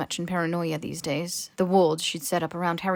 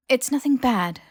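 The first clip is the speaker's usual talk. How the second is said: hastened reassurance; 6 semitones higher, 6 dB louder than usual. A narrator's reading. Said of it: "she hastened to add".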